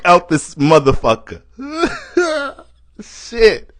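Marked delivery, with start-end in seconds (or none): 1.6-2.5 a short, high burst of laughter. Real, not acted. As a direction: laughs at his own punchline. Laughing at own joke.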